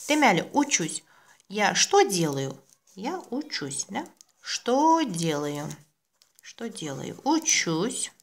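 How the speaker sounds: ordinary talk, nothing marked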